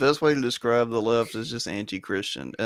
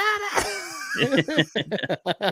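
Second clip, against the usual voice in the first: high laugh; 14 semitones higher than usual. Real, not acted.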